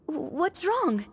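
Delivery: high, stammered worried question; alarmed question. Acted. Companion reacts to a sudden gasp, alarmed. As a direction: stumble on the first word, pitched high.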